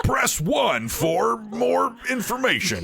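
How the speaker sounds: very slow, drawn-out automated menu voice; Movie Phone announcer. Acted. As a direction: stretch each word like a phone recording. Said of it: "this is Movie Phone".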